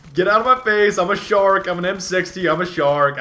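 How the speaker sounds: loud, high gleeful taunt; pumped-up shouted brag; loud boast. Pretend. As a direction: bellow it like a cocky predator. Gleeful shark boast in fight.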